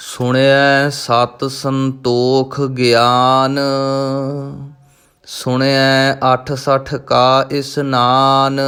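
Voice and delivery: slow, measured recitation of scripture; Gurbani recitation. Shown as a demonstration. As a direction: intone each line with devotion. Recites Gurbani lines in lesson. (Gurbani ਦਾ ਸਹਿਜ ਪਾਠ)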